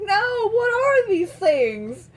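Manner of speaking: high, drawn-out bewildered protest; mock exasperation. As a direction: protest slowly, high and overwhelmed. Overwhelmed daughter reacting, retold.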